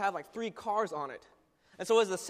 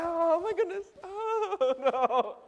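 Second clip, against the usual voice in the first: high-pitched panicky wail; hammed-up terror, pitched way up; freaking out; 10 semitones higher, 4 dB louder, much slower than usual. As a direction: wail it as the climb drags on. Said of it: "I'm, like, freaking out".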